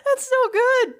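high, choked-up gushing; tearful with delight. Real, not acted. Co-host crying over a pun, high tearful delight. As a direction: squeal it through happy tears. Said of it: "you're crying over the beauty of a pun".

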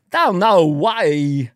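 very slow Walken don't know why; Walken puzzled. Retold or imitated. Comic teaching Walken's baffled line, very slow. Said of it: "Walken bit continued".

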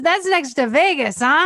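mock Hawaiian pidgin question. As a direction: imitate the local guy making an innocent guess. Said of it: "in his pidgin English".

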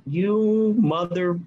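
drawn out slowly in stunned disbelief. Retold or imitated. Realizing the thief stands before him, slow stunned disbelief. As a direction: let the words crawl out, stunned. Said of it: "I was like".

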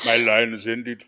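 a hint of a film steward's doom; lightly voiced tragic Lord of the Rings line; Jon Noble. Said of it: "Jon Noble from Lord of the Rings".

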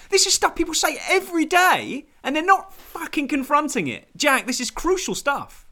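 high and loud, hammed-up indignation; mock outrage. Insisting to the panel that pronunciation matters, in hammed-up outrage. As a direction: rant about trivia as if it were life or death.

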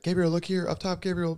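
high-pitched mock paparazzi call; imitated paparazzi yelling. Husband mimics photographers on red carpet, yelling. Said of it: "they're screaming at you".

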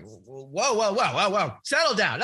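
loud, high-pitched, rising panic; acting out a startled dad's alarm. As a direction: blurt it out loudly to halt an outburst. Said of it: "I was afraid of his emotion".

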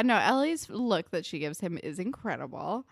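normal talking voice

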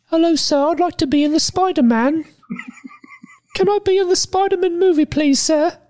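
put-on very high, squeaky polite British boy voice; Tom Holland impression. Cohost shows off his Tom Holland impression, squeaky high voice. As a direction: play him as an eager, tiny schoolboy begging. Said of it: "I can do a bullseye Tom Holland".

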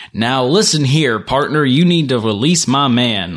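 slow, mock Southern cowboy accent, Southern accent; playing a Southern sheriff, slow and easy; Southern drawl. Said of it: "he doesn't obviously say it in a Southern accent".

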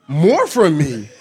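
slow, laughing disbelief; stunned disbelief. Retold or imitated. Husband reacting to his wife's request, slow laughing disbelief. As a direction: drag it out, can't believe it. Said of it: "And I was like".

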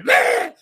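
a sudden, high, loud "bleh" like an autoplay video; mock blaring noise. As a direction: blast out a shrill noise burst. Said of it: "one of the tabs is like".

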